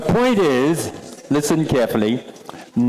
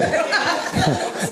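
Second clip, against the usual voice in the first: high laugh; 13 semitones higher than usual. Real, not acted. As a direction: let a light high laugh escape. Laughs at the phone story.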